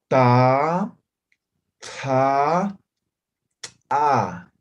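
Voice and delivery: plain ta, aspirated ta, then explosive ta; ta, ta with breath, ta with a burst; Aymara three-level demo. Shown as a demonstration. Teacher modelling Aymara consonant series, loud and deliberate. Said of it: "Los tres niveles de sonido".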